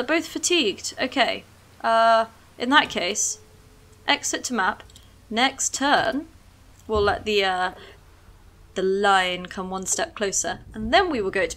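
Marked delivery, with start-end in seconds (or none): none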